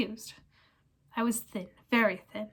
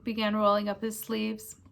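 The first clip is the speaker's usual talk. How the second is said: plain reading voice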